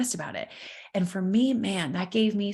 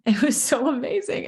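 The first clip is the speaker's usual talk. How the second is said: laughing it out, higher and louder; bubbling over with happy laughter; joyful laugh; 6 semitones higher, 3 dB louder than usual. Real, not acted. Laughing with joy about finding women.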